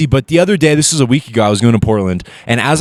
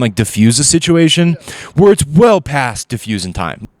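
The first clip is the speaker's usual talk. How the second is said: normal talking voice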